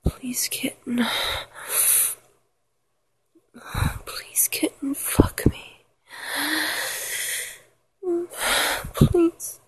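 urgent whimpered plea, broken by breaths; whispered begging. Acted. Lover begging a partner, whimpering whisper. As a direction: beg in a whisper, building on the last word. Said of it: "Every time I beg you".

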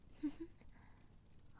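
quiet breathy giggle; soft giggle. Pretend. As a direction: giggle softly.

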